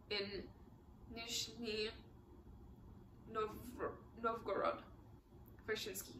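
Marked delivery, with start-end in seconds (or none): none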